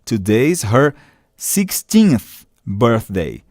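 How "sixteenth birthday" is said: "sixteenth" drawn out slowly, ending th with the tongue on the teeth; teacher spelling out "sixteenth" slowly for the class, ending in th; slow clear sixteenth. Shown as a demonstration. Professora pronuncia "sixteenth" bem devagar, com th no final. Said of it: "o T H ao final de sixteen".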